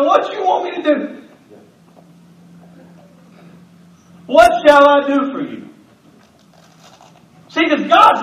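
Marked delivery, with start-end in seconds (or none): 0.0-5.7 God asking. Acted. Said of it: "God said".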